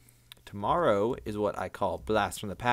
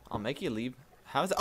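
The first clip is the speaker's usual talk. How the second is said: ordinary talk, nothing marked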